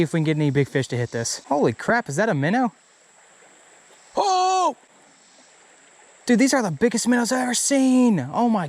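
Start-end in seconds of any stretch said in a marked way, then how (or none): 4.2-4.8 startled oh. Real, not acted. Surprise at huge minnows.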